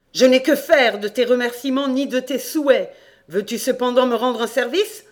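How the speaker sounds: loud, high-pitched irritated voice; scornful rebuff. Acted. Damned landlord rejecting the farmer's thanks, loud scornful rebuff. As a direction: brush off the thanks loudly.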